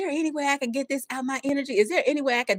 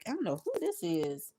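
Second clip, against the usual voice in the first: whisper; 5 semitones lower, 8 dB quieter than usual. Real, not acted. Musing aside while reading.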